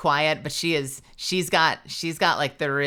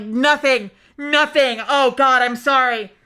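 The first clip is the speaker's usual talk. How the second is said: higher, louder flustered denial; 8 semitones higher, 8 dB louder, slower than usual. Retold or imitated. Caught out by a friend, flustered and loud. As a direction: scramble to cover an embarrassing moan.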